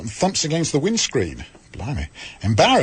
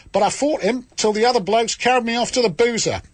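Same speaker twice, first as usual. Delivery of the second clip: put-on the same broad Australian accent carried through the sentence; a hammed-up Australian accent at full stretch; 6 semitones higher than usual. Host telling the letter's boxing story in a bad Australian accent. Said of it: "I think I did my best".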